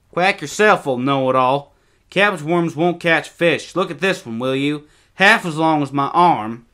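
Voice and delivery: put-on high, loud boyish retort; bickering young boy. A narrator's reading. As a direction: show off to a rival brother. Boys bicker over bait.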